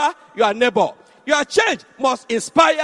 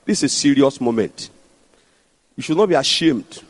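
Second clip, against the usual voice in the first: lower, hushed prayer-leading voice; altar call prayer; 9 semitones lower than usual. Acted. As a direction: pastor guiding the congregation in prayer, low and solemn.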